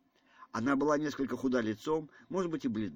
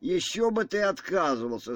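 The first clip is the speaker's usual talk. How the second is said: louder, higher, annoyed retort; vexed; 6 semitones higher, 5 dB louder than usual. A narrator's reading. General retorts to Ганя about refusing, with vexation. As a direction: throw it back with open irritation. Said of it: "с досадой проговорил генерал".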